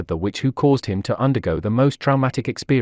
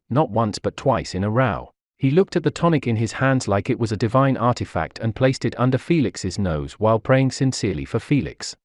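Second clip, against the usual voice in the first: ordinary delivery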